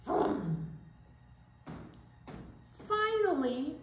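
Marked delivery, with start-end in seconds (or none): 0.0-0.7 low rumbling vroom like a truck; engine vroom. Pretend. Reading to kids, a truck engine vroom. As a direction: make the truck rev.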